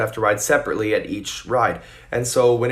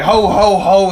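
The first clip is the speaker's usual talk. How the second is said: Santa ho ho; 8 semitones higher, 9 dB louder, slower than usual. Pretend.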